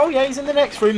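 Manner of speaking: brightly helpful housewife; cheery wife quote. Retold or imitated. Retelling the liver sketch, high and breezy.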